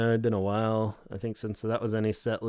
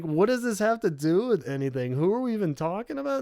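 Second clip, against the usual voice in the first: higher, quicker confused Robert; lightly voiced growing bewilderment; 7 semitones higher than usual. Robert lost in the makeup lady's story, lightly confused. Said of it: "And then he's like".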